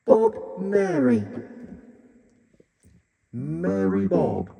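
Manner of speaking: high, slow, flanged voice; sing-song Bob Mary through flanger; flanging effect. Shown as a demonstration. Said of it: "flanging vocals".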